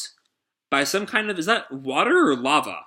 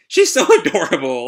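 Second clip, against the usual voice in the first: laughing; 4 semitones higher, 7 dB louder than usual. Real, not acted. Laughing at cute character.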